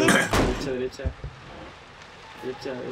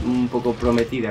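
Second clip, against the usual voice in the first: ordinary talk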